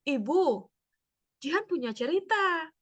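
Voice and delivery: put-on a kid eager to tell mum something; a hint of an excited little girl; eager child. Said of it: "cerita-cerita lucu dari seorang anak".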